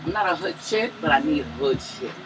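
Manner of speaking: normal talking voice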